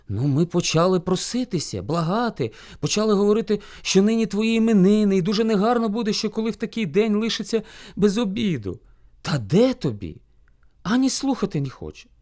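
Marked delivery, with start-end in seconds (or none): none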